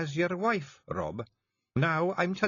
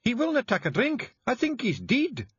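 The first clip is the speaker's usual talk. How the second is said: high, loud Scottish panic; alarmed Jeanie; 5 dB louder than usual. A narrator's reading. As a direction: fear that Rob is dead.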